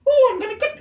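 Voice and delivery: very high squeaky cartoon mouse voice; a failed try that comes out as Mickey Mouse. Shown as a demonstration. Showing the sack voice, accidentally doing Mickey Mouse. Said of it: "oh, that's Mickey Mouse".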